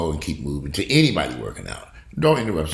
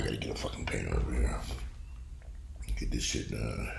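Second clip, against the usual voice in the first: much softer, low, fast grumble; exasperated mutter; 9 semitones lower, 13 dB quieter than usual. Real, not acted. Can't find a painter, muttering to himself. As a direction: grumble it under your breath.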